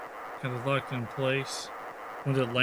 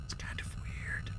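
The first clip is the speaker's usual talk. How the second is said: whispering; 12 dB quieter than usual. Real, not acted. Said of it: "Why are we whispering?".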